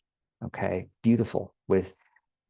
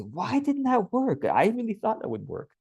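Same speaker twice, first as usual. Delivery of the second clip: high-pitched, puzzled wondering; genuine curiosity; 12 semitones higher than usual. Acted. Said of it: "genuine curiosity".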